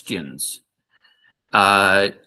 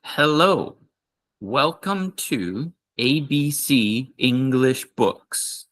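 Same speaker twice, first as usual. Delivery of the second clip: slow example greeting; 3 semitones higher, slower than usual. Shown as a demonstration. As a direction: model it slowly for learners. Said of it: "for example".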